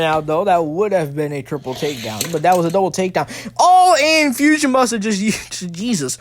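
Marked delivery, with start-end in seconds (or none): 3.6-6.2 high, drawn-out commentator's cry; excited outburst. Real, not acted. Commentator seeing a car flipped onto its roof, excited. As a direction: cry out slowly as the flip unfolds. Sudden flip during live commentary.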